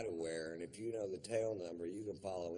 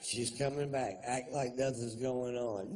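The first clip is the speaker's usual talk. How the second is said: usual speaking voice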